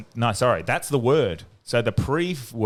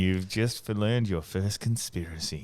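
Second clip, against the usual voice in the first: mock ominous; 4 semitones lower, 6 dB quieter, slower than usual. Continues the mock conspiracy intro.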